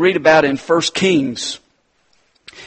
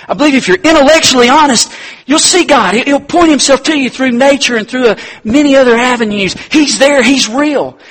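higher, louder, fast urgent preaching; preaching at full force; 9 semitones higher, 6 dB louder than usual. Acted. Preacher urging the congregation that God is real, fervent. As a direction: drive it home with conviction.

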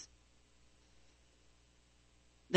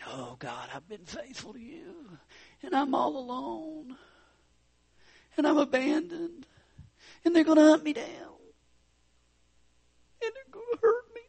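self-pitying whine that climbs higher and higher; mock whining; 10 semitones higher than usual. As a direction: whine like a sulking child. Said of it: "the whining is on full blast".

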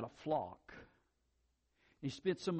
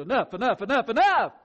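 building louder and higher, half laughing; mocking inner voice; 5 semitones higher, 10 dB louder than usual. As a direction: hammer the word enough mockingly. Mocking the inner voice saying enough.

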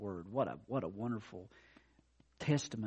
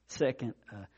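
normal talking voice

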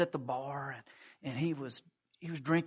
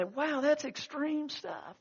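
slow, high, incredulous wow; mock astonishment; 11 semitones higher, slower than usual. A skeptical listener reacting to the story, slow and amazed. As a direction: play the doubting listener, amazed. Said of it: "You say".

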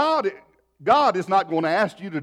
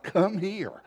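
insistent summons; 5 semitones higher than usual. Retold or imitated. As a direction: imitate a wife's higher, insistent beckoning. Wife repeats summons after his "What?.

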